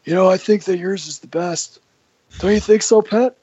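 hammed-up boss fishing for agreement; Johnny impression. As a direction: boss asks Pat to agree, high mock impression. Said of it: "everybody has their own Johnny impersonation".

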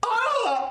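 high short cry of pain. Acted. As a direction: yelp as the knife goes in. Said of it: "he goes".

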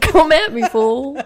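hammed-up tough taunt; mock tough challenge.

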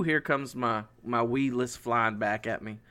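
normal talking voice

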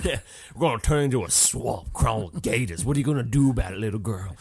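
hammed-up Southern swamp villain; bayou swamp man. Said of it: "the guy from the bayou".

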